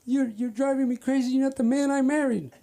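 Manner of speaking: high-pitched mock wailing wife; exasperated wife. Wife fed up with paranoid husband, high exasperation. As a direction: imitate a wife at her wits' end. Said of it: "She said".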